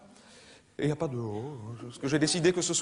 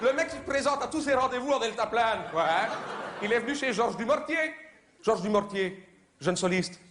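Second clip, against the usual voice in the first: ordinary delivery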